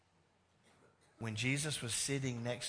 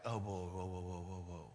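astonished whoa; 7 semitones lower, 7 dB quieter than usual. Retold or imitated. The audience marvels at the Samaritan, low, soft and stunned. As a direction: marvel quietly at something never seen. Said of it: "they're like".

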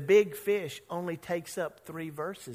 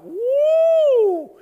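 high loud whoop; inner cheer; 13 dB louder than usual. Retold or imitated. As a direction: cheer like a standing ovation. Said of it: "standing ovation, except inside".

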